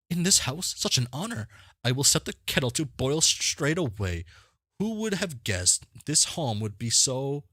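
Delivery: high, excited fawning voice; high-pitched starstruck host; gushing game character. Acted. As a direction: welcome a famous guest in high, theatrical gushes.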